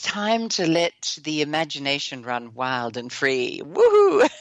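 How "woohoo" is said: high, loud whoop of delight; gleeful whoop. Real, not acted. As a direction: let out a high, celebratory cheer. Cheer for imagination running free.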